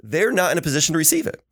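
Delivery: ordinary delivery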